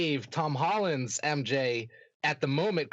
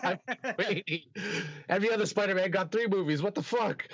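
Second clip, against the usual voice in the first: higher-pitched playful complaint, speeding up; comically aggrieved imitation; mock grievance; 5 semitones higher than usual. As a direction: act out an actor pleading his case, half-joking. Voicing an eager, aggrieved actor.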